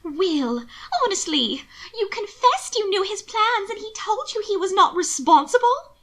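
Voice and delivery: high, incredulous questioning; aghast. A narrator's reading. Sister challenges her brother's trust, aghast and high. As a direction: pitch climbing in disbelief. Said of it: "to stare at him aghast".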